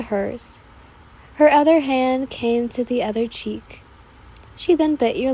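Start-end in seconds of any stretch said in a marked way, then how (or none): none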